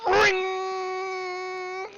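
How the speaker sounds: high, held "ringgg" on one note; ringing bell imitation. Game master voicing the bell at the top, long high ring. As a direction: sound out a bell and let it hang. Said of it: "you hear the bell go".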